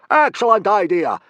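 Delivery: very loud, high, delighted; happy bellow. A narrator's reading. Gobber delighted at the volunteer, bellowing happily. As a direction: boom it out gleefully. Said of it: "bellowed Gobber happily".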